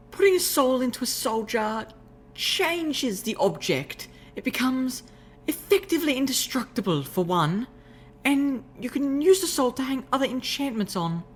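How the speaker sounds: high refined lady's voice, explaining; refined unicorn explaining. Acted. Rarity explaining soul jars, high refined voice.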